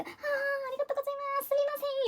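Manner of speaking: high, soft, drawn-out Japanese politeness; apologetic thanks. Retold or imitated. To a stranger warning about the flying poncho, high apologetic thanks. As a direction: squeak out grateful apologies softly.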